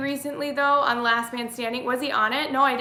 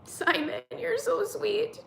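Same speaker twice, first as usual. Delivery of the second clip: cooing to cat; 12 semitones higher, 4 dB quieter, slower than usual. Real, not acted. Cooing to her cat Simon.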